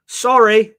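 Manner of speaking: lightly hammed Canadian apology; Canadian accent, single sorry; mock Canadian. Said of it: "in Canadian".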